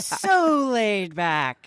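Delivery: very slow, lazy and drawn out. Pretend. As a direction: stretch each word out slowly. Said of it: "librarian voice".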